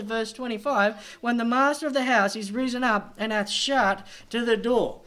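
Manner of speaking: loud, urgent sermon delivery; forceful preaching. Real, not acted.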